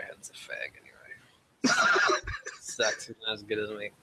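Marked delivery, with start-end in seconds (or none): 0.1-1.7 whispered aside. Pretend. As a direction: whisper it as a sneaky jab. Jokey aside about absent cohost.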